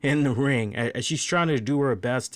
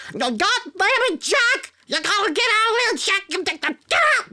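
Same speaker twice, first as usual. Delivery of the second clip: very high, fast and loud, full squeal; shrill mock of the ref telling him off; high-pitched referee voice; 5 dB louder than usual. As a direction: squeak it out like an indignant little referee. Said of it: "every time".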